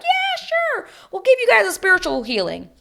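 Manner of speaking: very high-pitched, put-on eager voice; mock agreeable producers. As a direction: parody producers happily going along with it.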